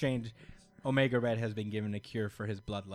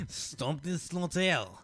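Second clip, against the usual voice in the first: higher, hammed-up French vampire; bad French accent; 5 semitones higher, much slower than usual. Host voices the French vampire about to feed, mock French accent. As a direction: declaim it in a terrible French accent. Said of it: "I can't really do a French accent".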